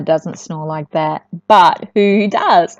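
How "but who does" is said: ordinary delivery, nothing marked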